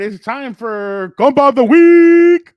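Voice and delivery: high, loud, slow show-announcer call; segment announcer. Acted. Host kicks off Compa of the Week, booming announcer call. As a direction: stretch it out like a game-show host introducing the segment.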